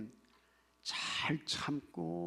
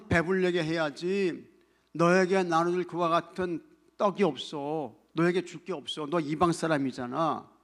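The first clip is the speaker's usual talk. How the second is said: loud acted dismissal; stern rebuff; 4 semitones higher, 8 dB louder than usual. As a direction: turn someone away firmly, as if playing the part. Acts Jesus rebuffing the woman.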